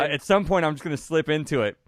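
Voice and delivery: bouncy playful refusal; playful excited tease. Real, not acted. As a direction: gleefully dodge the request. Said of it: "Come on, man".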